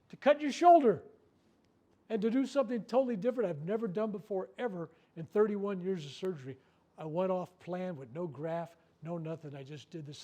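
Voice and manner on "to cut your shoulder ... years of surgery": much higher and louder, bursting out; nearly shouting his inspired idea; excited outburst. Retold or imitated. Surgeon to patient, proudly explaining his new idea, loud and excited.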